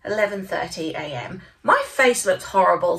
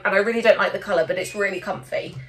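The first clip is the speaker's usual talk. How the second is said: ordinary delivery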